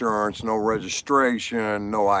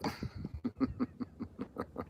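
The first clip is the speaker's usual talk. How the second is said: laugh; 13 semitones higher, 19 dB quieter than usual. Real, not acted. Laughing at own punchline.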